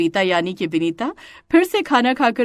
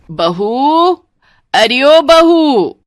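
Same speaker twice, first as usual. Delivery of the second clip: loud call, stretched and rising to a yell; 6 semitones higher, 8 dB louder, much slower than usual. Acted. Mother-in-law summoning the bride to the door, hollering. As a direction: holler for someone indoors.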